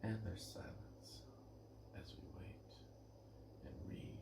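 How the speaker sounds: hushed whisper, like a golf commentator; whispered aside. Pretend. Whispering to the audience during a quiet pause. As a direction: whisper the silence to the listeners. Jokey narration of the silence.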